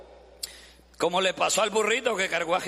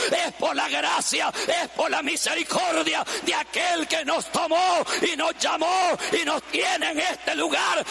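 fervent preaching; 7 semitones higher than usual. Acted. Preacher to congregation, shouting praise at full force. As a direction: exhort the crowd at full force.

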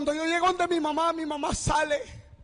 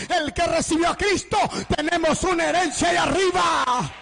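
louder, rising sermon exhortation; fiery preaching; 2 semitones higher, 5 dB louder than usual. Acted. Preacher urging the young to make peace, loud and fervent. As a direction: drive the warning home to the congregation.

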